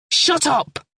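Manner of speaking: high sharp yell. A narrator's reading. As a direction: yell to silence her. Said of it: "he yelled".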